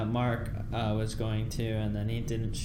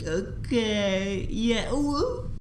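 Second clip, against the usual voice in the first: Mark's giddy acceptance; 9 semitones higher, 3 dB louder, much slower than usual. Retold or imitated. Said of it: "And Mark's like".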